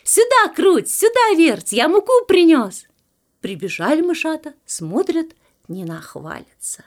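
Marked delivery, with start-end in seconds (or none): 0.0-2.8 high, loud summons; calling out. A narrator's reading. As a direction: call the little ones over. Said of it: "Опять зовёт мышат". (громко и высоко зовёт)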